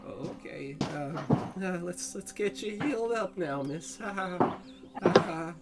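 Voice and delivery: hesitant, lightly rattled, with nervous fake laugh; falter, then force a nervous little chuckle; a little shaky. Threatened shopkeeper offers healing to the customer, mildly shaky. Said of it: "he gets a little bit kinda, uh, shaky".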